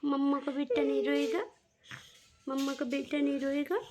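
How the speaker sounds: motherly singsong comfort; tender soothing. Real, not acted. Mother coaxing baby to stop crying.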